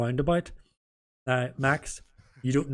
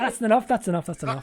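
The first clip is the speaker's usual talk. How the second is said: higher and louder, laughing through it; laughing stop; 6 semitones higher, 4 dB louder than usual. Real, not acted. Host stopping the swearing while laughing, laughing. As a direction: call a halt while cracking up.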